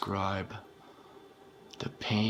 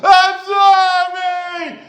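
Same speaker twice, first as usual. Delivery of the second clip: shrieked apology, much higher and louder; desperate screaming plea; 16 dB louder, slower than usual. Acted. Begging after hurting him, shrieking.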